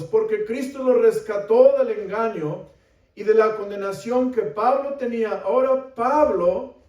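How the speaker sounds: high, loud, emphatic sermon; impassioned preaching. Real, not acted. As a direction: preach it forcefully to the congregation. Preaches with rising force.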